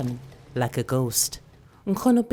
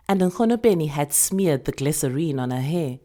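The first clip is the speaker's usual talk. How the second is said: ordinary delivery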